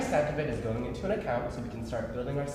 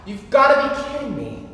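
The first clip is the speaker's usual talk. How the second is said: higher, louder disbelief; exasperated outburst; 11 semitones higher, 9 dB louder than usual. Acted. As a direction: complain loudly at the racket. Bagpipes interrupt again.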